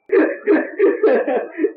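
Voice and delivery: bright burst of high laughter. Real, not acted. Preacher laughing at his own story's punchline.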